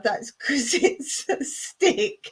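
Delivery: giggling through the punchline; can't get it out for laughing; reading it aloud while cracking up. Real, not acted.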